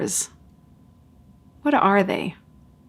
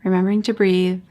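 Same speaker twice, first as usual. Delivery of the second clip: ordinary talk, nothing marked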